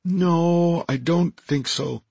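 high, slow alien voice; high-voiced Deltan. Deltan answers whether friend will be questioned, high slow voice. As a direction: answer slowly in a high creature voice. Archimedes answers in his character voice.

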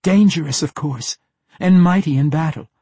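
high, loud captive woman; anxious answer. A narrator's reading. Captive tells the assassin about the dwarf, anxious answer.

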